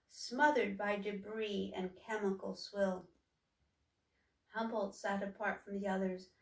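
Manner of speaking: plain reading voice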